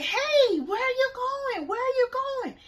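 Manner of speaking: very high, loud shout; calling after her. Retold or imitated. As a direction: yell after someone walking away. Calling after departing friend.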